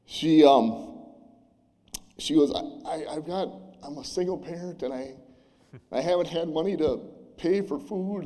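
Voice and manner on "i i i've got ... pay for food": choking up, voice catching on the words; real tears rising as she speaks, pitch lifting and thinning; voice breaking. Real, not acted. Retelling the single mother's words, voice breaking with emotion. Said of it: "I always get emotional up here".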